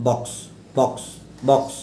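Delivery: "বক্স" with a short o; short o sound. Shown as a demonstration. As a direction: keep the vowel in "বক্স" brief. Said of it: "short যে অ sound".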